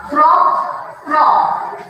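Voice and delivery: throaty frog croak, second one breathed; demonstrating the frog sound to pupils. Shown as a demonstration. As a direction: croak like a frog to show the class. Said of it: "It says".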